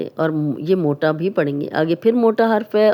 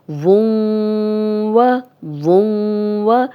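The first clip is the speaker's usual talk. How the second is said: slow, drawn-out chanted recitation; slow melodic tajweed; Quranic recitation; 3 semitones higher, much slower than usual. Shown as a demonstration. Recitation of the verse.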